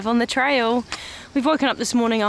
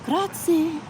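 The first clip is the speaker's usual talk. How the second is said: high, sing-song Italian Grazie with a rolled r; best Italian accent; 10 semitones higher than usual. Retold or imitated. Thanking the bus driver in her best Italian accent. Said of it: "in my best Italian accent".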